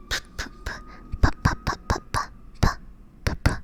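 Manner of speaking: repeated short popping P bursts; P, P, P as isolated little puffs; popped P sounds. Shown as a demonstration. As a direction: pop the P sound over and over. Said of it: "this one is like a P sound".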